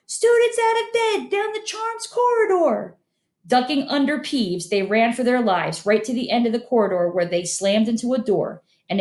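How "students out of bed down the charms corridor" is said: very high bellowed alarm; bellowing. Acted. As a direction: shout it down the corridor. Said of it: "Peeves bellowed".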